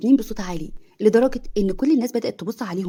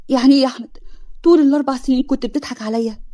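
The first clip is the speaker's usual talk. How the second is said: tearful, pitch raised, louder; wounded and near tears; crying; 5 semitones higher, 5 dB louder than usual. A narrator's reading. Hanin asking Ahmed through tears. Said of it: "حنين ببكاء".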